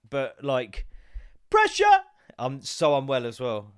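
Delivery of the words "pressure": sudden high shout of pressure!; mock excitement. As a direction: blurt it out high and loud as a joke. Mock thrill at time pressure.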